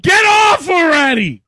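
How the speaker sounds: loud exasperated yell; shouting. Real, not acted. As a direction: yell at the intruder to get off. Yelling at the stream intruder.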